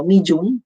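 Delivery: "Medium" as two syllables, mee-joom; "Medium" squashed to mee-jum; J and long E. Shown as a demonstration. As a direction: say "Medium" Filipino-style with a j sound. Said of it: "with a J".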